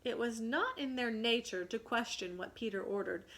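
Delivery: usual speaking voice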